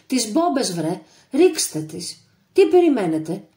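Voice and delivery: loud, high furious yell; angry shout. A narrator's reading. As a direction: scold the men for holding back. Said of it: "θυμωμένοι ψώνονταν ολοένα η φωνή του". (θυμωμένη κραυγή)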